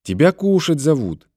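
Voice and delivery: put-on high-pitched child's voice; girl passing on a message, pitched high; little girl. A narrator's reading. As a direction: speak up as a small girl in the next room. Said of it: "донёсся из комнаты негромкий голос девочки".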